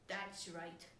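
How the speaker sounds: low, very quiet sour reply; grim father. Acted. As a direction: grumble it low to yourself.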